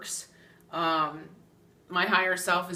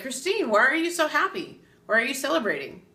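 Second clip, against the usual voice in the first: curious friend; 6 semitones higher, 3 dB louder, faster than usual. Retold or imitated.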